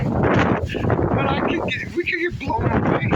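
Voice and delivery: high, loud alarmed outburst. Real, not acted. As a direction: cry out as the wind hits. Wind blowing, hair messed up.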